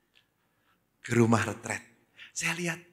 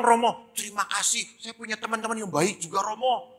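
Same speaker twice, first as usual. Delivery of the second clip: put-on high, animated child's storytelling; schoolchild; 7 semitones higher, 5 dB louder than usual. Polio boy tells priest of mama and friends, eager child voice. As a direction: recount it eagerly like a little pupil. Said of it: "Saya ketemu anak S D".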